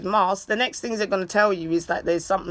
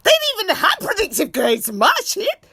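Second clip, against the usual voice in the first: mock outrage; 11 semitones higher than usual. Mother mock-outraged at her daughter's complaint. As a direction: act shocked and indignant. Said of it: "I was like".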